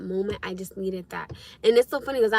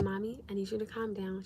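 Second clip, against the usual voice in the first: soft-spoken, kind reassurance; hushed, understanding, easy voice; very calm tone; 12 dB quieter than usual. Retold or imitated. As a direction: imitate a gentle responder soothing a worried mother. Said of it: "very calm tone".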